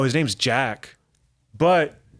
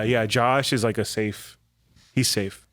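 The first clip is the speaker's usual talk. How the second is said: ordinary talk, nothing marked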